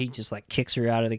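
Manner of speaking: ordinary delivery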